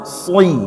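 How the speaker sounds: "Sli" as a heavy sad with i, like "swi"; thickened sad. Shown as a demonstration.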